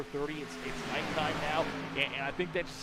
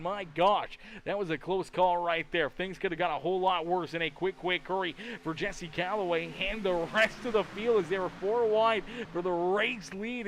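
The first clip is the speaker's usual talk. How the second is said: brisk play-by-play call; steady live sportscaster read; race commentary; 4 semitones higher, 3 dB louder than usual. Acted.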